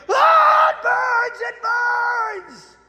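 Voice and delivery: very high screeching demon cry; shrieking demon. Retold or imitated. Demon shrieks at holy water.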